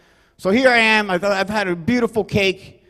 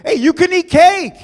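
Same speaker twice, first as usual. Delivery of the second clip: high, loud host's holler; screaming; 8 semitones higher, 6 dB louder than usual. Acted. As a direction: cry it out high to the whole party. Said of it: "I'm just screaming".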